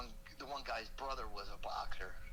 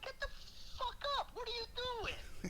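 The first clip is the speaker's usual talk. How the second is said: yelling in shock. Retold or imitated. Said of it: "I'm like".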